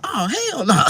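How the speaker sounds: high loud scoff; passengers scoffing. Retold or imitated. Bus riders laugh at him, scoffing high and loud. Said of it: "They were like".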